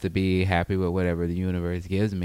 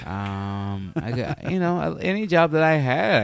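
normal talking voice

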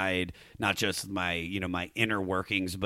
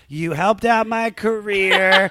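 hammy speech; 9 semitones higher, 6 dB louder, much slower than usual. Pretend. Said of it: "when I'm like".

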